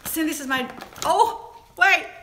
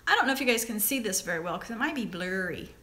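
ordinary talk, nothing marked

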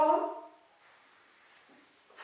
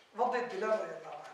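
normal talking voice

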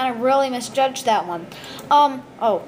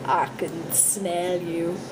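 creepy slow whisper; 6 semitones lower, 6 dB quieter, much slower than usual. Pretend. Kid plays a creepy figure.

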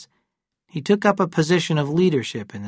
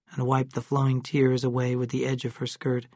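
ordinary delivery, nothing marked

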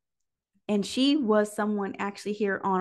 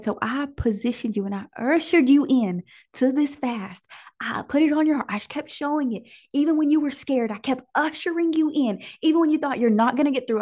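higher and louder, building fervor; fervent preaching; 5 semitones higher, 4 dB louder than usual. Acted. Preacher voicing God to the fasting women, fervent. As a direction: preach it with rising passion.

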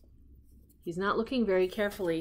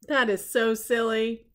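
ordinary delivery

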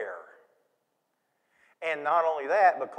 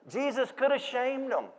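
usual speaking voice

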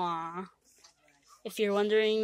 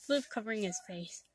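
ordinary talk

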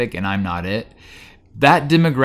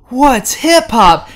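much higher, louder and slow; mock clueless question; 13 semitones higher, 8 dB louder, much slower than usual. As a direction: play the kids who never heard of hip hop. Kids have never heard of hip hop.

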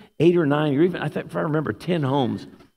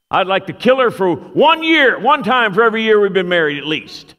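loud, high-pitched imitated holler; gruff old man shouting; 7 semitones higher, 7 dB louder than usual. Old husband takes the microphone at his fiftieth anniversary, loud gruff shout. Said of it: "loud, uh, kind of, uh, gruff".

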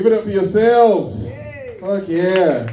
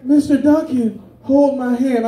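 lightly voiced student, pitched up; 8 semitones higher than usual. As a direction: imitate a pupil asking the teacher, higher. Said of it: "one day she said".

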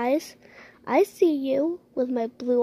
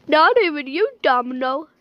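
usual speaking voice

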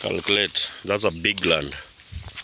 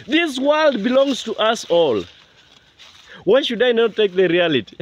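higher-pitched, impassioned protest; indignant protest; 7 semitones higher, 3 dB louder than usual. Real, not acted. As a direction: protest as if wronged. Protesting at callers telling him to quit.

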